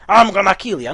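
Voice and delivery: loud threat in the Naga voice; the right take of the Naga line. Retold or imitated. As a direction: threaten like the caught villain. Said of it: "she definitely said".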